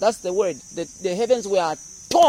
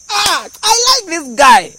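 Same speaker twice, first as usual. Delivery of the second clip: much higher, louder, slow delighted shout; delighted God; 9 semitones higher, 11 dB louder, slower than usual. Pretend. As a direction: boom out your delight in him. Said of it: "God was like".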